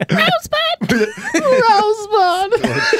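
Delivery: squeaky sped-up chipmunk squeal; high squeaky cartoon rodent cry, laughing. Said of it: "Chipmunk voice".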